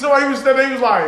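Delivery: ordinary delivery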